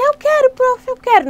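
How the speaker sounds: put-on high, pushy child begging for candy; high childish whine; whiny kid. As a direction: beg like an impatient little kid. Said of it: "não adianta ele falar assim".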